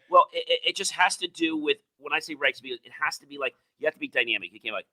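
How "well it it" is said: ordinary talk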